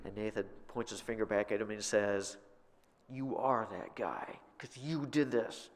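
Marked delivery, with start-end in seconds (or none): 3.1-5.7 slow, pointed accusation. Acted. Nathan confronting David, slow accusing delivery. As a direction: lay each word down slowly, pointing at him.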